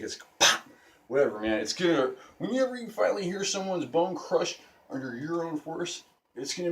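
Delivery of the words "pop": loud popping sound effect; short, loud unvoiced pop; imitated stick snap. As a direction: pop it out abruptly like a breaking stick. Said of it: "it probably sounded like a stick".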